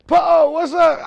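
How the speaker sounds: high, loud friendly call across the street; excited greeting. Retold or imitated. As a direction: hail him like an old friend. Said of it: "He like".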